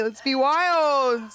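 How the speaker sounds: high, loud, drawn-out cheer; mock wild urging. Daughter urging mom to change her look, mock wild. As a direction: stretch it out like a rallying cry.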